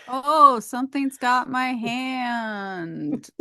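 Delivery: slow, hammed-up fake fright; mock spooky Tina. Retelling Tina's fake scare at the candy bowl, slow mock fright. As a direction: drag it out like a bad haunted act.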